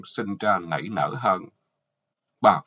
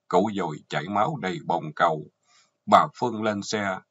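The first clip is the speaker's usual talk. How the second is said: usual speaking voice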